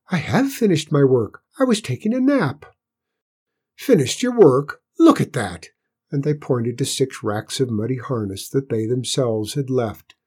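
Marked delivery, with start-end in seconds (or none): 0.1-2.6 high-pitched, hurried protest; quick squeaky Cinders. A narrator's reading. Cinders defends himself to bullies, quick and squeaky. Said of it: "he answered quickly". 3.8-5.7 loud, jeering challenge; scornful bullying. A narrator's reading. Stable boys confront Cinders over muddy harness, scornful.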